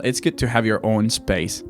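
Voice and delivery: normal talking voice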